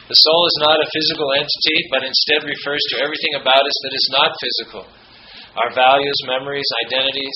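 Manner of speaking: steady, earnest read-aloud; formal quote reading. Acted. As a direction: read it out like scripture from the pulpit. Reads Kushner quote aloud.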